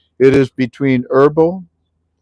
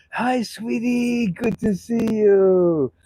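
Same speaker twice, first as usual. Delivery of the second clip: sweet greeting; 11 semitones higher, 5 dB quieter, much slower than usual. Real, not acted. Greeting a friend arriving.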